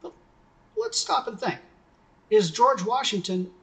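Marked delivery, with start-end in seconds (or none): none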